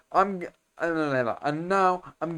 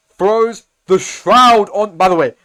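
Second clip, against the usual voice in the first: much louder, higher, slow play-by-play; slow, much louder excited commentary; hyped fight call; 6 semitones higher, 12 dB louder, slower than usual. Real, not acted. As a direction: call it like a big fight moment.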